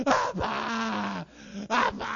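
loud, sobbing cry for daddy; imitated wailing child. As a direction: bawl it out like a spanked little boy. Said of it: "he's wailing".

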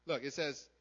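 usual speaking voice

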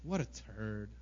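low, quiet contempt; muted, disgusted aside; acted annoyance. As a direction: let it drop after a sigh. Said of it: "if I'm just like".

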